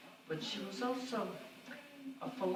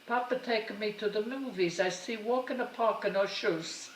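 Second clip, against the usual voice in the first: mother's broken English; 4 semitones higher, 3 dB louder than usual. Retold or imitated. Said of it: "and she says".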